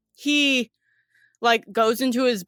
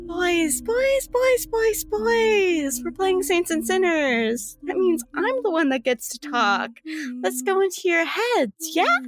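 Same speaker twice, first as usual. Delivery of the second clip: much higher, teasing sing-song; Stasia's sing-song voice; 9 semitones higher than usual. Acted. Stasia calming the quarreling boys in a high, teasing sing-song. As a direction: coo at them like naughty children.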